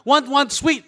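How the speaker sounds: ordinary talk, nothing marked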